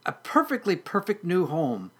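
high, contented kitten voice; purring kitten. Acted. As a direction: purr it sweetly, up high. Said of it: "purred the kitten".

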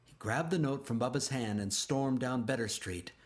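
plain reading voice

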